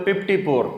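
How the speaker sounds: fifty-four said as پپټی پور; every F a P in پپټی پور; پپټی پور with popped P sounds. Shown as a demonstration. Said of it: "نو مونږ وایو".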